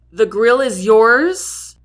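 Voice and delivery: slow, loud doubtful question; dubious question. A narrator's reading. Jack questioning Lexi about the grill, slow dubious call. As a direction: doubt her claim out loud. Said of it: "he questions, looking dubious".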